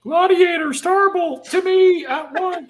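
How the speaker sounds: high, loud, squeaky cartoon bureaucrat; micromanager voice. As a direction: bark orders like a tiny Lego micromanager. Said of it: "That's the micromanagers in the Lego Movie".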